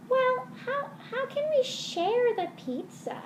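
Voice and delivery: a sad little puppet near tears; whiny puppet voice. Acted. Puppet whines.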